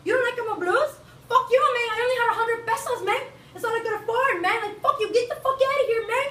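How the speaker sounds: high, loud mock fury at the buyer; mock angry hustler. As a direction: chase the customer off, hammed up. Acted hustler snapping at customer.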